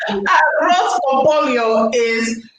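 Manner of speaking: slow, Spanish-accented "Arroz con pollo"; drawn-out Spanish pronunciation. Shown as a demonstration. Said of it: "put on my little Spanish accent".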